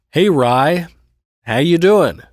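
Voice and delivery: high, loud, cheery greeting; determinedly upbeat hello; upbeat, cheerful. A narrator's reading. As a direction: put on a bright, dutiful-brother cheer. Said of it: "I kept my voice as upbeat as I could".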